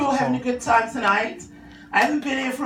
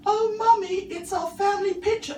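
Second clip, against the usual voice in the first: put-on much higher innocent child voice; little boy voice; 9 semitones higher than usual. Son proudly showing his mum the family picture, high and innocent. Son answers about the wall.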